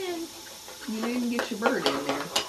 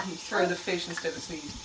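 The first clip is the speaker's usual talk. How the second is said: usual speaking voice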